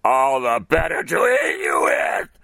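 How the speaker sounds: high, loud snarling shout; roaring wolf. Acted. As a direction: pounce with a shouted wolf roar. Wolf pounces.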